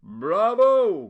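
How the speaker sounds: loud, high mocking cheer; loud cry of bravo. A narrator's reading. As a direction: cheer it out sarcastically. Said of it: "cried Paolo".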